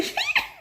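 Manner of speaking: high squealing giggle; gleeful squeal. A narrator's reading. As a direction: squeal with delight like a poltergeist. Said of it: "gave a squeal of delight".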